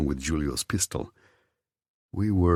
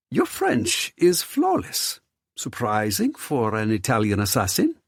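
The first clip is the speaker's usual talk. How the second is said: flamboyant surprised host; 6 semitones higher than usual. Acted. Theo surprised by Alessio.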